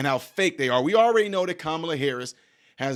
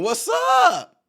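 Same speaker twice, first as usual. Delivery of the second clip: very high, loud shouted greeting; mock foreign shout; 6 dB louder than usual. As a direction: yell it like an eager visitor. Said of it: "somebody from another country coming over here".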